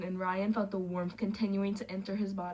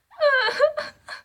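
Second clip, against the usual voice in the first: high squealing burst of laughter; shrieking laugh; 11 dB louder than usual. Real, not acted. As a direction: lose it laughing out loud. Reader cracking up at text.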